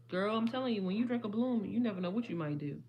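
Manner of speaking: ordinary talk, nothing marked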